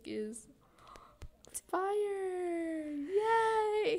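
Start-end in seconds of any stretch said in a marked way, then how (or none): 1.7-4.0 high, loud fake cheer; mock cheer. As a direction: cheer it with a laughing cry. Mock celebrating a grim topic.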